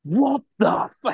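high, slowed-down mock disbelief; imitated shocked caddy. Co-host acting out caddy in slow-motion video, shocked. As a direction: draw out the caddy's astonishment in a high voice. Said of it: "caddy just being like".

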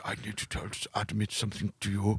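a quiet, secretive confession from the Swedish lad; hushed confession. Retold or imitated. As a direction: lean in and confess softly, holding the secret close. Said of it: "He was like".

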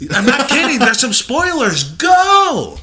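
loud high shout. Acted. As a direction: yell the warning out. Yelling spoiler warning.

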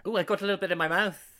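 fast, loud, high burst of delight; excited exclaim. Real, not acted. Co-host recalling haggis hitting his mouth, fast and excited. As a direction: blurt it out, thrilled.

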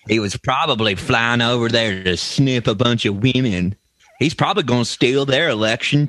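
lightly voiced political impression, taunting the crowd; a hint of a blustering politician; mock Trump impression. Mocking Trump voice about Biden.

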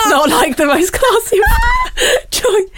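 high squealing laugh through the words; giggling high through the line. Real, not acted. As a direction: laugh it out at the memory. Laughing at her own story.

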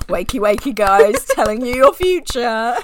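loud, hammed-up fortune teller; mock fortune teller. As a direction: call out like a carnival psychic. Mock fortune teller wake-up call.

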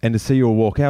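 ordinary talk

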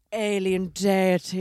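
slow, drawn-out mock-dramatic announcement; imitating a catchphrase. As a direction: draw out each word like a grand reveal. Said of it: "Anton always goes".

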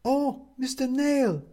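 high, slow, concerned woman's voice; worried neighbour woman. A narrator's reading. Upstairs neighbour finding him on the floor, worried.

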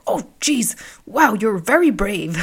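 mild mock astonishment at a swimmer; a hint of a cheerful countryman marvelling; impressed local man. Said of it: "And he was like".

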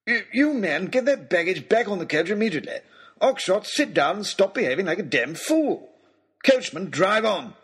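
high, spluttering angry orders; exasperated aristocrat losing his temper; apoplectic lord. A narrator's reading. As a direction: rap out commands in outrage. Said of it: "turned an interesting colour".

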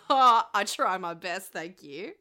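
put-on Australian accent. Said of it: "gotten more Australian".

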